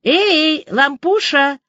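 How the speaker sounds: calling out. A narrator's reading. Liza calling from the hallway after school, calling out. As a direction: holler a cheeky hello down the hall. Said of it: "донёсся из прихожей голос Лизы".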